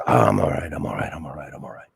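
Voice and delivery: imitating her brushing it off, dropping to a whisper; fading whisper.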